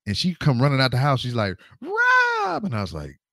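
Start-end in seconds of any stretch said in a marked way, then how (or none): 1.8-2.6 high call of his name across the yard. Retold or imitated. She calls after him.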